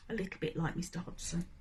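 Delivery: cheeky muttered joke; quick muttered aside. Real, not acted. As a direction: toss it off under your breath.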